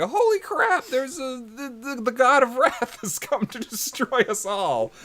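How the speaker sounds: high-pitched hammed-up terror, stammering midway; mock panic. Retelling villagers seeing the god of wrath, mock panicked wail. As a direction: cry out as if doom is coming. Characters panicking at approaching lights.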